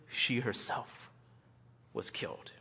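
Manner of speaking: hushed reveal. Acted. Sermon climax of martyr story.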